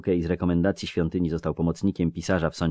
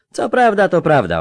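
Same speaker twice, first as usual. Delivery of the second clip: eager country lad voice; a raised, lively village boy; rustic young recruit; 13 semitones higher, 11 dB louder than usual. Acted. Melfi agrees.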